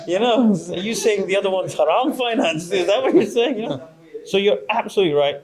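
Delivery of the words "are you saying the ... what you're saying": mock challenger. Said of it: "I've heard this before".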